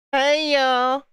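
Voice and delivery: high-pitched, badly faked Southern drawl; butchered Southern accent. Shown as a demonstration. Demonstrating an accent to chat, butchered Southern drawl. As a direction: sing out a hammed-up Southern greeting. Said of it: "I'm butchering the accent right now".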